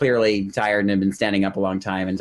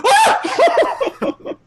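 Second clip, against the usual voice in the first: high shriek breaking into laughter; high gleeful yelp dissolving into laughs; excited shriek; 4 dB louder than usual. Retold or imitated. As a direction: let out a thrilled squeal, then laugh. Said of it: "couldn't help myself".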